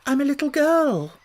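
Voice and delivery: put-on high, hesitant little girl; Alice answering doubtfully. A narrator's reading. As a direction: say it unsure of yourself. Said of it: "rather doubtfully".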